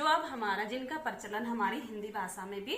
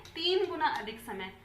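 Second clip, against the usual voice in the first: normal talking voice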